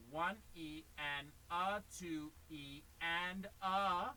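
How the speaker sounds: slow, drawn-out count. Shown as a demonstration. Teacher counting slowly for the student, drawn out. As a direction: spell out each subdivision slowly. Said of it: "You go".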